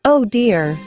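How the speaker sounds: ordinary delivery, nothing marked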